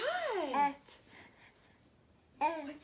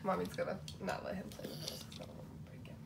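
whispered aside; 10 semitones lower, 8 dB quieter, much faster than usual. Real, not acted.

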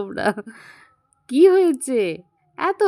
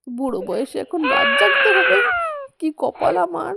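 much higher, crying out in self-pity; wailing lament; 11 semitones higher than usual. Acted. Grandparent wailing about night duty, crying out. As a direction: wail over having to stay up in old age.

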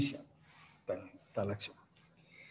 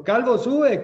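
loud, high jeering chant; mocking taunt; 11 semitones higher, 9 dB louder, much slower than usual. A narrator's reading. Boys jeering at the bald prophet on the road, loud mocking chant. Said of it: "se burlaban de él".

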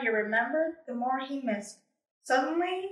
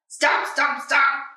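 stomping steps; 6 dB louder, slower than usual. Acted. Footsteps coming closer.

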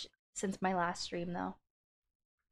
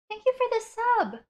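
excited; 11 semitones higher, 8 dB louder than usual. Real, not acted. High cheerful thanks.

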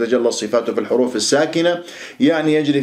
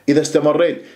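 usual speaking voice

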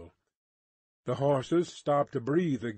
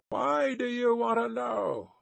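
high, called-out question; guard's high wary holler; suspicious challenge; 9 semitones higher than usual. A narrator's reading. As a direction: challenge a stranger from afar. Guard challenges the distant rider.

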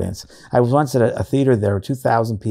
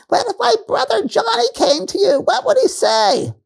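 very high, loud calling voice; imitated lady in balcony; 4 dB louder than usual. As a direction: call up from the balcony, slowing at the end. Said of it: "this lady who said".

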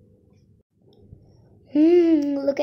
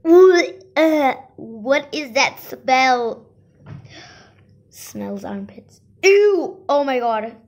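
loud disgusted shriek; 9 dB louder, slower than usual. Acted. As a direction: recoil from the smell. Smelling own armpits.

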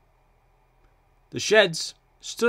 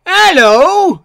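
very high, loud engine greeting; loud, high-pitched call; booming hello; 15 dB louder than usual. A narrator's reading. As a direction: boom it out down a passageway. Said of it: "booming through the passageway".

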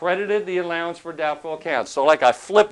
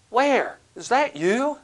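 surprised; 7 semitones higher, slower than usual. Retold or imitated. Surprised to spot old debtor.

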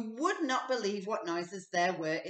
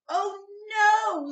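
high, loud weary groan of a fed-up father; a tired dad at the end of his rope; exasperated Mister Bear; 12 semitones higher, 9 dB louder than usual. A narrator's reading. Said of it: "said Mister Bear".

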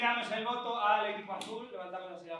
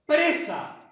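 announcing; 9 semitones higher, 13 dB louder than usual. Real, not acted. Host announcing the title.

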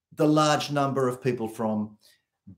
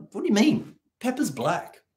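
baffled self quote; 8 semitones higher than usual. Retold or imitated. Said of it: "I'm like".

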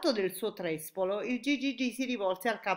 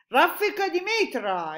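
loud, high battle cry; exclamation; 10 semitones higher, 10 dB louder than usual. A narrator's reading. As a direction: call it out like an order to fire. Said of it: "esclamò il capo dell'esercito". (esclamazione forte e acuta)

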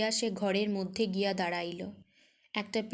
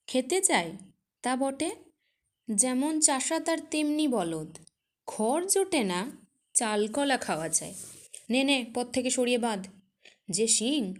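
scornful; 4 semitones higher, 4 dB louder than usual. Acted. Priest scorns the farmer's plea.

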